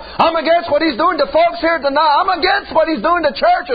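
high, fervent preacher's cry; preaching at full force. Acted. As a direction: preach it out with fire.

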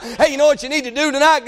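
normal talking voice

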